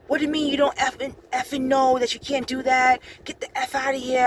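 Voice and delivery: high, fast mock rant; imitating an irate man swearing; irate guard. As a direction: spit out the censored curses in fury. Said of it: "he was just irate".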